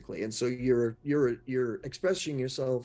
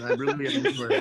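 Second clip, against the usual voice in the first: sorry said through real laughter, pitched way up; giggling, much higher apology; laughing apology; 12 semitones higher, 5 dB louder than usual. Real, not acted. Laughing apology after derailing.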